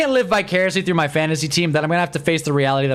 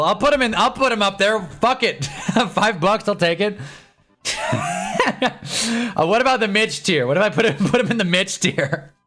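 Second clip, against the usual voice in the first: higher, giggly and amused; laughing; 6 semitones higher than usual. Real, not acted. Streamer laughing at a viewer's five-dollar bribe. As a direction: laugh through the words.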